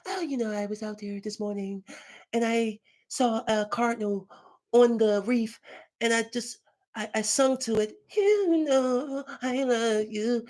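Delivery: high, soft put-on falsetto; a pop star's dreamy, airy story voice. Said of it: "he was faking".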